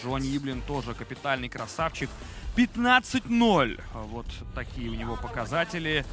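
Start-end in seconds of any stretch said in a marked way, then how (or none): 2.5-3.8 emphatic hype. Real, not acted. Hyping a fighter's record.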